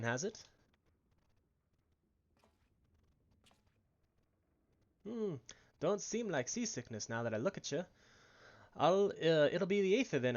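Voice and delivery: put-on a rough, hearty Irish accent, mostly held. Brennan the peddler waking the lass on the ship, in an Irish accent. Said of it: "I think it's Irish".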